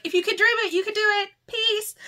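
high, loud, perky answering-machine message; imitating a peppy recorded greeting; best friend's voicemail greeting. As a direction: chirp it like an upbeat voicemail. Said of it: "She would just be like".

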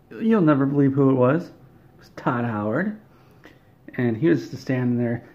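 ordinary delivery

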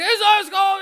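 very high mock yell; yelling last call. Staff still shouting at drinkers after last call, mock yelling. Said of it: "they just keep yelling at you".

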